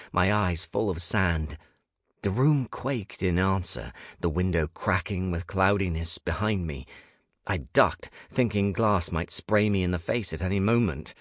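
plain reading voice

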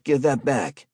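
loud, high, alarmed demand; alarmed protest. A narrator's reading. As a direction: yell at him to stop. Harry protests being robbed.

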